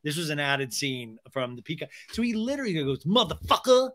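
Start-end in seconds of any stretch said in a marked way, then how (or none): 3.0-3.9 weird delivery. Retold or imitated. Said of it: "says motherfucker weird".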